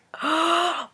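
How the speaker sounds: sharp high gasp of alarm; panicked gasp. Retold or imitated. Retelling the spill to viewers, high gasp.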